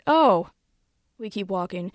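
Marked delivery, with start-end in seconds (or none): none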